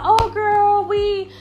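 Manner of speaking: high, put-on girlfriend voice; fake apologetic excuse. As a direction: mimic a friend making a phony excuse. Voicing friends' fake excuse for excluding her.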